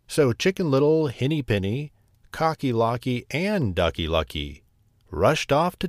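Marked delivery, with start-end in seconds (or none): none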